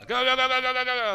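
high, loud rapid urging; loud, high chanting while rushing through the level; urgent cheer. Real, not acted. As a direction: chant it fast and loud to hurry. Urging himself through action.

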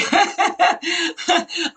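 high, open burst of laughter; big laugh. Real, not acted. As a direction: let a real laugh break out.

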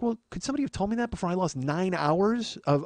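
normal talking voice

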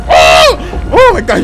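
loud, high yelp of fright; startled scream. Real, not acted. Player spotting the creepy figure, startled scream. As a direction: cry out as the creepy thing appears.

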